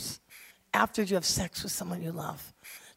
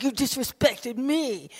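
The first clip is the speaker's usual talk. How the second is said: mock offended retort; 3 semitones higher, slower than usual. Acted quarrel with family member.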